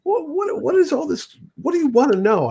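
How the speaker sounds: high-pitched, overwhelmed imitation; freaking out. Idol swamped by a beginner's thousand questions, freaking out. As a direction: stretch the opening, then stammer up high. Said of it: "Howard's, like, freaking out".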